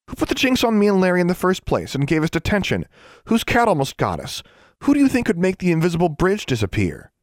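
high, heated string of questions; insistent accusation. A narrator's reading. Girl persuading skeptical friend about the Dean, insistent. Girl lists evidence to doubter.

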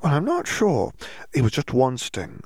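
put-on high, dreamy and woozy little girl; dazed young girl. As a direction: float through it, pleasantly drugged. Dazed Hermione voice.

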